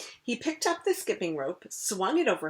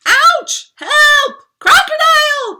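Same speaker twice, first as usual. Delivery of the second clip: very high, loud panicked cry; king bitten by the crocodile; cried; 18 dB louder, slower than usual. Acted. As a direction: scream for help. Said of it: "cried the king".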